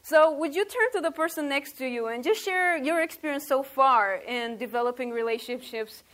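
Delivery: ordinary talk, nothing marked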